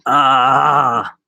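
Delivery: loud drawn-out "ahh"; sustained loud exclamation. Shown as a demonstration. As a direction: let out a long, loud open "ah". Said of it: "those things where I go".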